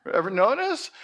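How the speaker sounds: ordinary delivery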